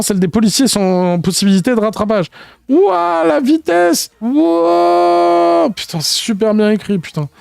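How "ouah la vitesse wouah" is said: high excited cry; high thrilled shout; thrilled whoop. Acted. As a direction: cheer at the sudden speed. Characters thrilled by nitro boost.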